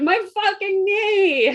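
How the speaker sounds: slow, high, pained cry of agony; pained yell. Acted. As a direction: howl about the knee, drawn out. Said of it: "right now he's like".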